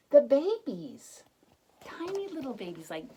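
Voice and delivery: delighted big reveal; excited reveal. A narrator's reading. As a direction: announce the babies with wonder. Reveal of tiny babies.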